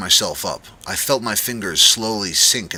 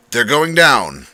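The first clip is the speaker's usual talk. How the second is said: loud, tight through gritted teeth; irritated cook answering the manager through gritted teeth; 6 semitones higher, 11 dB louder, slower than usual. A narrator's reading. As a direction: force it out between clenched teeth. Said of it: "through gritted teeth".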